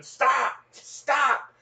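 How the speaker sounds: high yelled stop; screaming stop. Retold or imitated. Pa screaming at the outlaws, high loud yell. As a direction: scream it to halt the gang. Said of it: "my pa started screaming".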